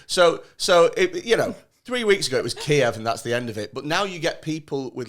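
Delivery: ordinary talk, nothing marked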